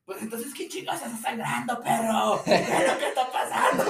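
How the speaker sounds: laughing through a mock angry yell; outraged friend shouting, half laughing. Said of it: "Y el otro, el otro morro".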